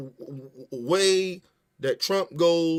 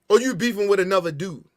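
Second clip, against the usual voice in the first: usual speaking voice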